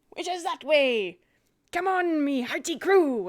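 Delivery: rally the crew pirate-style; hearty pirate. Acted. Pirate rallies his crew, mildly hearty.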